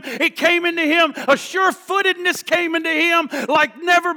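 high, driving, rhythmic exultation; full-force preaching. Acted. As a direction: build each repeated phrase like a revival preacher.

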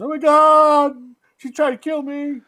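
high, loud mock wail of alarm; mock hysterical. Co-host acting out the psychopath faking an attack, mock hysterical. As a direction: cry out in hammed-up panic. Acted quote of film villain.

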